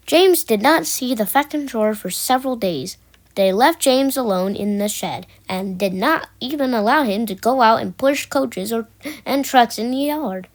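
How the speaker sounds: ordinary delivery, nothing marked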